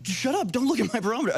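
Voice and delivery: high, fast, mock defensive; mock defensive snap. Host mimics people defending their conscience, fast and high. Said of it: "they're like".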